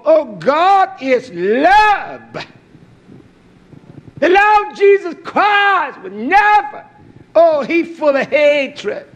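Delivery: very high, slow, drawn-out mock piety; mocking a critic. Preacher mocking critics who call God only love, in a high whining voice.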